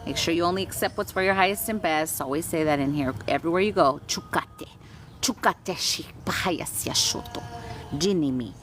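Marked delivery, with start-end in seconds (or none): 4.1-8.5 soft light language. Acted. Healer quietly channeling light language for viewers, hushed. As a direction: murmur the channeled words gently.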